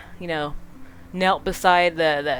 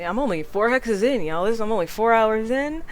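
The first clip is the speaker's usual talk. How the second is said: usual speaking voice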